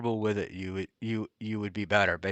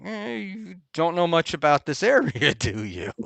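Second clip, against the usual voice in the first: mock incredulous reply; 6 semitones higher than usual. Acts own reply to naive suggestion.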